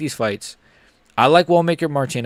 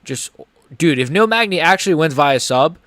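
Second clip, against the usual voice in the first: ordinary talk, nothing marked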